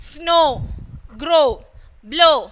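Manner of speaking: snow, grow, blow with a long "oh"; O as in tomorrow. Shown as a demonstration. Teacher reviewing the tomorrow column, long O demo. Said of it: "pronounced as in tomorrow".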